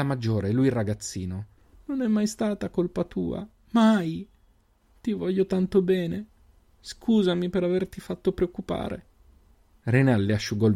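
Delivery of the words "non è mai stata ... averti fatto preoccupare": put-on high girl's voice, slow and tender; tender little sister. A narrator's reading. Sister comforting her brother, high and tender. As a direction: comfort him like an older sister.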